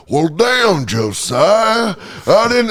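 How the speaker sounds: high, hammed-up cowboy drawl; mock Western gravel pitched high; Arthur Morgan voice. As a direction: do the homeless guy as a cowboy. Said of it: "our homeless jerk-off guy voice".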